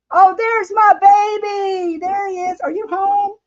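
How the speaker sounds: high, thrilled call to her husband; light up and call out to him; excited greeting. Real, not acted. Greets husband coming home.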